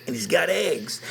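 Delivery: ordinary talk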